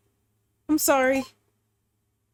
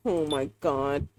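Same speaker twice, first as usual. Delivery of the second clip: much lower and softer, slow; stunned hush; 11 semitones lower, 6 dB quieter, slower than usual. Real, not acted. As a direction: breathe it out in shock. Player stunned, Soul is gone.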